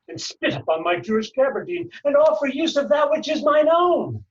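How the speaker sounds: building to a loud, high cry of outrage; all-out theatrical indignation, climbing to a shout; fully committed outburst. Acted. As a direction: let the accusation erupt at full voice. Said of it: "Really commit".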